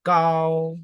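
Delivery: "kao" held long and level; first tone. Shown as a demonstration. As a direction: keep "kao" even, no movement. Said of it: "avec le premier ton".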